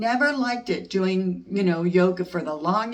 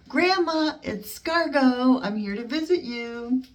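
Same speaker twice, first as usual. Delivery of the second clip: put-on playful child call; 7 semitones higher than usual. Child voice at door.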